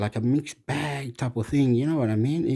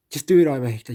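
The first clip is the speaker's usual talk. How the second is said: urging call; 4 dB louder, much faster than usual. Real, not acted. Urging Hector loudly.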